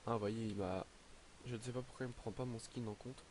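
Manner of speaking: ordinary delivery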